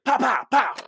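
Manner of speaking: loud high mock gunfire; gunshot noises. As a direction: shout out the shots like a kid playing. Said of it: "And he's like".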